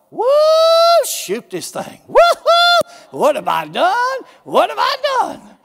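high, loud mock whooping then panicked; mock hollering buyer. Preacher mimics people hollering over new things, hammed-up whoop. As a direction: ham up a giddy buyer turning to panic. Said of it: "then they holler".

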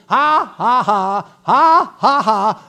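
loud, deliberate mock laugh; laughing at the devil. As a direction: punch out each taunting "ha". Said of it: "I'm a laughing at you".

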